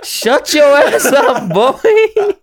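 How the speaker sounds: loud, high, drawn-out playful yell; mock telling-off. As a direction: shout them down in jest, stretching it out. Mock telling-off amid laughter.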